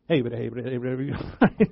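low, rattled-off auctioneer patter. Acted. Preacher mimicking the guy at the auction block, auctioneer chant. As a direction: babble it like a livestock auctioneer. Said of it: "like the guy at the auction block".

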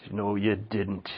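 low, very soft mother's voice; low, quiet disbelief from Mom; mock maternal contradiction, hushed and low; mom imitation. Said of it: "That's how my mom talks".

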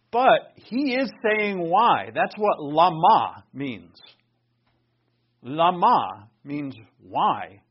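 Lama means why, slowly and loud; emphatic, slow. Real, not acted. Preacher insisting to the congregation, slow and emphatic. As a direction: press each word home. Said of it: "stress on why".

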